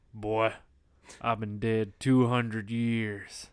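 slow, drawn-out retort; lightly voiced vampire, stretching out the words; Blackula. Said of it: "and Blackula's just like".

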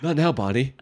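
ordinary delivery

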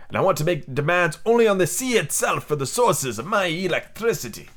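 put-on lilting, hammy foreign accent for a sea captain; theatrical captain with an unplaceable European accent; mystery foreign accent. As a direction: explain it in a wandering, vaguely French accent. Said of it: "I don't know what accent this is".

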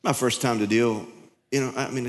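normal talking voice

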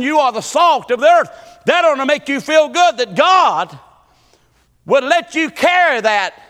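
high, loud preaching cadence; preaching at full force. Acted. Preacher calling out to his congregation in full preaching voice.

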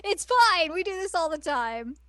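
chirpy mock reassurance. Popping up from under his hair, high chirpy reassurance. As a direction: pipe up high and breezy.

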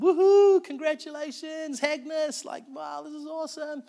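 high, drawn-out imitation of whooping; cheering party crowd. Diner crowd surprising Agnes on her birthday, mock high-pitched cheering. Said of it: "straight away they're all like".

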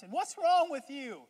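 high, loud scolding of the cheerful one; exasperated Eeyore rant. Pretend. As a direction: berate him like he's lost his mind. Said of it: "us Eeyores think".

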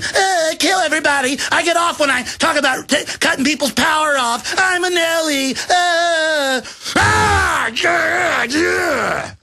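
shrieking simpering parody; simpering Nelly voice. Retold or imitated. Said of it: "chicken neck Nellies going".

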